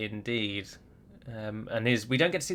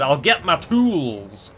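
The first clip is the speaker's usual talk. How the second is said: Scottish dwarf; 3 semitones higher, 6 dB louder, much slower than usual. Retold or imitated. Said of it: "those ones are Scottish".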